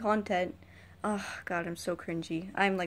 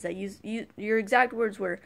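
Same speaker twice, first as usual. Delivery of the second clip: ordinary talk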